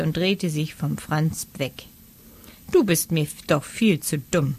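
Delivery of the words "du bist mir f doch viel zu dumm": ordinary delivery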